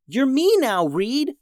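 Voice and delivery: high-pitched, startled voice; shocked exclamation. A narrator's reading. Harvey sees Reed become him, high shocked voice. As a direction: blurt it out high in disbelief. Brother shocked at seeing himself.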